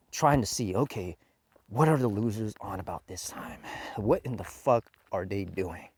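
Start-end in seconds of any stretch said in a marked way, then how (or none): 1.7-5.9 hushed sneer at the developers; a snide whispered aside; mock whisper. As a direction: whisper it like spying on them.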